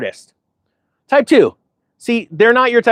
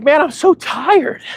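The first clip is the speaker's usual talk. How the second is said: higher, slow, worn-out sigh of a man; imitating an exhausted friend; 8 semitones higher, slower than usual. Young gentleman confessing to him at lunch, exhausted. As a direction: play the tired young man, drained. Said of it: "he's like".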